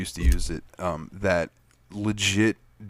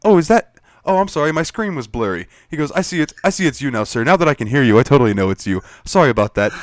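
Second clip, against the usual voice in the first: hammed-up apologetic guard, higher and louder; grovelling guard; 5 semitones higher, 7 dB louder than usual. Guard to player, suddenly grovelling apology. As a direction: backpedal like a scared guard. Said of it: "and he comes back".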